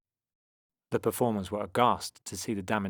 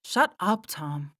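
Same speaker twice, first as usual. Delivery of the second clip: high, irritated snap; snapped; 10 semitones higher, 3 dB louder, slower than usual. A narrator's reading. Mia tells Tom to stop, snapping. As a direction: snap at a teasing brother. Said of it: "snapped Mia".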